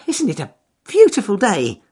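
high, loud cheerful bunny; cheerful rabbit voice. Acted. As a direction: call up brightly to the trees. Rabbit greets weasel.

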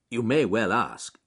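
ordinary delivery

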